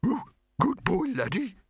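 very high dog bark in words; excited high doggy call. A narrator's reading. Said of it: "The barking was louder this time".

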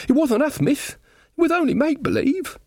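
high-pitched rustic protest; squeaky-high excuse to miss; pleading villager. Acted. Villager pleading innocence to Magrat.

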